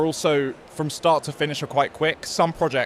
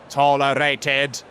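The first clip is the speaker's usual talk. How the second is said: slow, drawn-out Dalek Tolerated; much slower than usual. Shown as a demonstration. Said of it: "I love saying as a Dalek".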